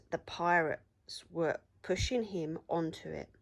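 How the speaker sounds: usual speaking voice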